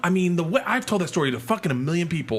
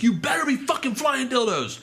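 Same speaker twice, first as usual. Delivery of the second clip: threatening heckle; 11 semitones higher than usual. Retold or imitated. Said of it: "and I'm like".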